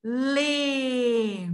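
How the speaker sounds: "lê" stretched on the E; teacher sounding out "lê" slowly; syllable demo. Shown as a demonstration. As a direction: hold the vowel of "lê" for the learners. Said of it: "L e lê fica".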